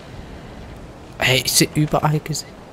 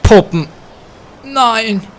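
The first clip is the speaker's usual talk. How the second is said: loud, higher scared cry at the dolls; fearful outburst; 10 semitones higher, 7 dB louder, much slower than usual. Real, not acted. Streamer crying out on spotting creepy dolls. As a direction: recoil with a yelp.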